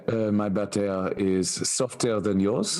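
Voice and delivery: hammed-up Parisian French accent, laughing; a bad Parisian accent put on for a joke. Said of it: "scathing of his accent".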